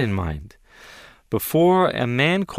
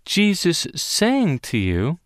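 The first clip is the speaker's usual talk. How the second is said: normal talking voice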